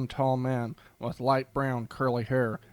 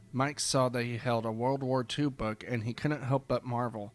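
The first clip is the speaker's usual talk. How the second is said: plain reading voice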